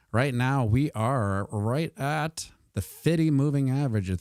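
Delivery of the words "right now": usual speaking voice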